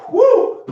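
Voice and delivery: high, loud whoop of energy; excited whoop. Real, not acted. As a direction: let out a big hype whoop. Mid-set burn, hyping through.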